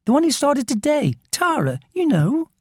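high-pitched, exasperated schoolgirl; impatient. A narrator's reading. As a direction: spell it out impatiently, voice pitched right up. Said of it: "said Meralda impatiently".

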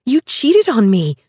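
angry yell, high and loud; furious. Acted. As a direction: accuse him, outraged. Bride furious at ex.

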